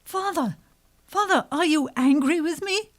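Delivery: high, pleading daughter voice; daughter's plea. A narrator's reading. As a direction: call up to father in worry.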